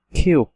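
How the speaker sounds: কিউক said as Korean "ki-euk", punched; teacher naming the letter কিউক; Korean letter name. Shown as a demonstration. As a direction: sound out কিউক crisply in Korean. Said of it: "এরকম একটা চিহ্ন যেটা হচ্ছে".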